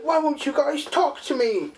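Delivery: put-on a high, whining child voice; high whiny plea. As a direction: whine it like a hurt kid.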